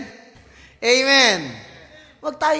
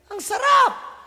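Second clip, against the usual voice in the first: excited shout; 13 semitones higher, 6 dB louder, slower than usual. Real, not acted. Crowd shouting praise.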